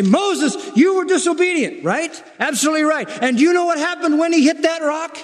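high-pitched, ringing rebuke; emphatic preaching. Real, not acted. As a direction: drive it home from the pulpit. Preaches at full force.

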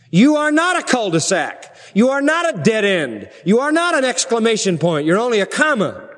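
high, swooping pulpit refrain; emphatic preaching. Real, not acted. Preacher telling believers they must reproduce, emphatic preaching. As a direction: hammer out each parallel phrase.

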